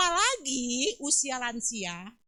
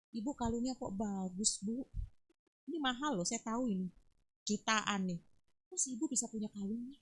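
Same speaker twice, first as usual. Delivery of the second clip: envious; 4 semitones lower, 6 dB quieter than usual. Acted. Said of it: "Itu namanya apa?".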